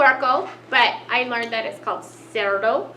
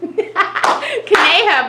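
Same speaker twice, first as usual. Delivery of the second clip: conejo drawn out slowly through a laugh; laughing correction; 5 semitones higher, 5 dB louder, much slower than usual. Shown as a demonstration. Teacher laughingly models conejo after dad's try. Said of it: "but he tried".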